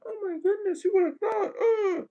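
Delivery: high falsetto mock gasp; mock shocked falsetto. Mocking people shocked by shadows, high falsetto.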